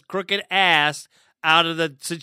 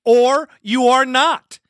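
emphatic shout; 6 semitones higher, 6 dB louder, slower than usual. Real, not acted. Ranting about defensive standards.

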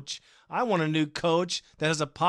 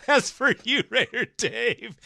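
high, giggly delivery; laughing out the words; 8 semitones higher, 3 dB louder than usual. Real, not acted. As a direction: crack up, then talk through the laugh. Laughs after his British accent reading.

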